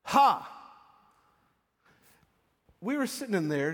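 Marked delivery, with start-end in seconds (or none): 0.0-0.5 loud, high one-syllable "Ha!"; loud cry. Acted. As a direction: cry out a single sharp laugh-word. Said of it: "in a loud voice".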